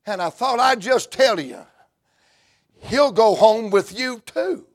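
normal talking voice

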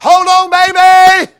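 high, loud cry to his daughter; urgent father's call. Acted. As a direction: call out to her over the distance. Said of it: "I believe he's saying".

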